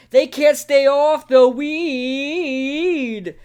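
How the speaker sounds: slow, drawn-out pundit rant, high and loud; Stephen A. Smith. Retold or imitated. Host quoting the pundit's Cowboys rant, slow and drawn out. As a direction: stretch every word like a TV pundit. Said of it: "I hope this is the one that says".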